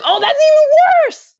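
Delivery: loud, high-pitched outburst of sympathy; exclaimed dismay. Real, not acted. Reader breaks off the story to sympathize, exclaimed dismay. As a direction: burst out loudly, pitch leaping up.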